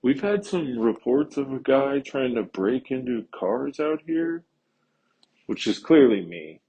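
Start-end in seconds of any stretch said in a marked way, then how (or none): 0.0-4.4 mimicking a polite teen security kid, higher. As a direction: put on a higher, overly polite young kid voice. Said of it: "he walks up and he's like".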